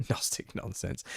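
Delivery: whispered jab at Gnostic nonsense; whispered aside. Real, not acted. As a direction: hush it like a sly aside. Parting muttered jab.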